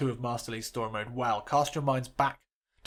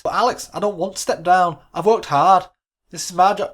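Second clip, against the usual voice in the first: pleading assistant; 5 semitones higher, 9 dB louder than usual. Acted.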